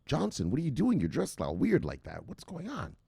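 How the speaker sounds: high, swooping put-on woman's voice; puzzled female coworker. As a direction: play Sally, baffled by the outfit. Voices Sally questioning Johnson.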